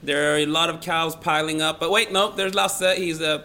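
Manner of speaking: loud, urgent live traffic bulletin; helicopter reporter. Pretend. Guest reporting cows piling up, mock helicopter reporter voice. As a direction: project it like breaking news from the air. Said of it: "talk in a helicopter when doing the weather".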